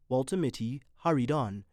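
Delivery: ordinary delivery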